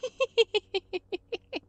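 high squeaky giggle; cartoon laugh. Real, not acted. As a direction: burst into a high-pitched giggle. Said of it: "I'm just giggling".

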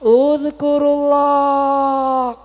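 high, long-drawn cockcrow in words; crowing rooster. Acted. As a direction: crow it out slowly like a rooster. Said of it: "Jagone sing urun pulsa".

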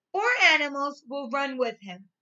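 ordinary talk, nothing marked

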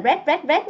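RED repeated as "rét", no d; mispronounced dress. Shown as a demonstration. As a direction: say red short, Vietnamese style. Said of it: "cái mọi người hay đọc là red". (đọc red thành "rét", mất âm đ)

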